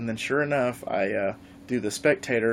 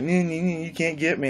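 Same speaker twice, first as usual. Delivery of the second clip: put-on childish taunt; 5 semitones higher than usual.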